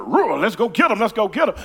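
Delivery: loud, hyped-up call to charge; eager rallying cry. Retold or imitated. Preacher mimicking himself rallying friends into a fight. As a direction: rile up the crew to go fight. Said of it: "I jump on a bandwagon".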